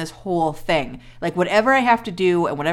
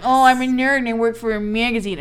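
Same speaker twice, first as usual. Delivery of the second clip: nerd voice; 4 semitones higher than usual. Retold or imitated. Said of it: "He's just like".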